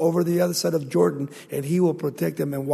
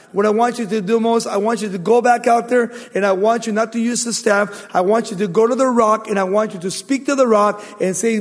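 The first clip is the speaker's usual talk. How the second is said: loud, raised storytelling from the pulpit; forceful preaching; 6 semitones higher, 8 dB louder than usual. Acted. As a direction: preach the story out with full projection.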